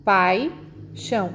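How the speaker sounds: "Pai, chão" with a clear gap; two spaced beats, pai, then chão; split into syllables. Shown as a demonstration. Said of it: "quando fazemos a separação".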